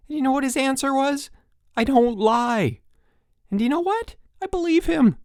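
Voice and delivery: very high, loud, worked-up rant; exasperated. A narrator's reading. Chip tells counselors about Miller, exasperated. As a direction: build to loud, high disbelief.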